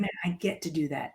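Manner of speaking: low, soft, appreciative murmur; grateful reframe. Shown as a demonstration. Daughter glad to care for her parents, quietly grateful. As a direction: say it softly and low, counting it a privilege. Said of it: "Or I could say".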